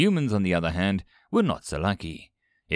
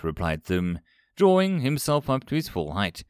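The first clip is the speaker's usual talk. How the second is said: ordinary delivery, nothing marked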